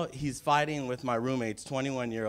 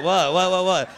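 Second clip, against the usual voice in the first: startled; 6 semitones higher, 8 dB louder than usual. Retold or imitated. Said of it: "I'm like".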